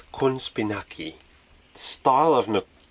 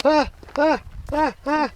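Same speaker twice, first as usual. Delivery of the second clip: yelps of pain. Real, not acted. Bitten by mosquito.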